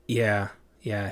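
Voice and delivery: ordinary delivery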